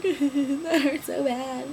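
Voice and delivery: slow, sobbing complaint; crying. Real, not acted. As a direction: cry the words out slowly. Crying after x-rays.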